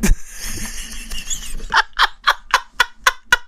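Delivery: breathless wheezing laugh, high; high squealing laugh. Real, not acted. Streamer cracking up at his own bit, wheezing. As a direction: lose it in a silent wheeze.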